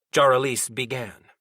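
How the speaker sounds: usual speaking voice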